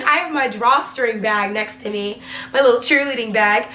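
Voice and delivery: ordinary talk, nothing marked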